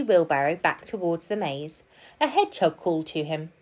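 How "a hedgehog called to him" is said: ordinary delivery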